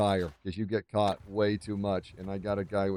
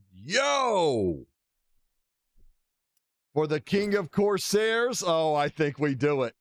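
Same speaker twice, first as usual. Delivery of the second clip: excited; 7 semitones higher, 3 dB louder, slower than usual. Real, not acted. Excited at new gear.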